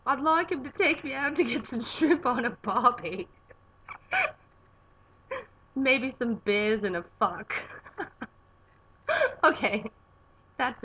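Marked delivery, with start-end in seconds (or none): 0.1-3.3 loud, high, hammed-up Aussie accent; put-on Australian accent. Shown as a demonstration. Explaining a perfect date in her best accent, Australian accent. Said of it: "I have to do an accent". 5.8-7.5 slow, loud Aussie accent; put-on Australian accent. Shown as a demonstration. Joking about a perfect date, slow Australian accent. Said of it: "I have to do an accent".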